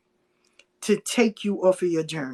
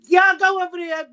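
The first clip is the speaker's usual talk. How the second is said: calling out; 8 semitones higher, 4 dB louder than usual. Retold or imitated. Directing people to the food.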